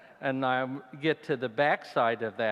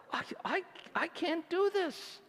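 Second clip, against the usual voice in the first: very high, frightened protest; high panicked voice of a hiker frozen at a cliff; retold terror. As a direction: squeak out the refusal in mock panic. Retelling his fear at the drop.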